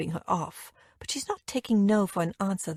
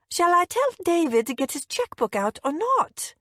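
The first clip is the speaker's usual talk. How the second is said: high, trilling cut-glass mother; pitched-up, impatient query; brisk prompting; 9 semitones higher than usual. A narrator's reading. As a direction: press briskly for an answer. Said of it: "she prompts briskly".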